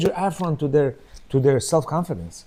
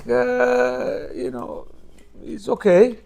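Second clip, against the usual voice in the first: hesitant reply; 6 semitones higher, 5 dB quieter, much slower than usual. Retold or imitated. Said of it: "I didn't like the way".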